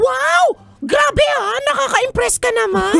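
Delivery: high shout. Acted. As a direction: squeal with delight.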